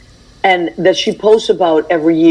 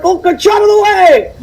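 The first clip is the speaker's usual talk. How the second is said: much higher, hammed-up dad voice; imitating her dad; 12 semitones higher, 3 dB louder than usual. Aunt acts out her dad carrying a tree, hammed-up. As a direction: bellow it like a strong dad hauling a tree. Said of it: "he'd be like".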